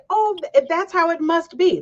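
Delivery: high-pitched imagined reaction; naive young onlooker. Retold or imitated.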